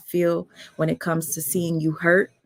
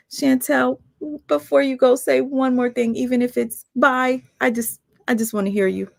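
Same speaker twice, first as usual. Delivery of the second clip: high, eager call; pleading brightly before she goes; excited plea; 7 semitones higher than usual. Real, not acted. Eager plea to guest before leaving.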